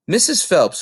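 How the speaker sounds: ordinary delivery, nothing marked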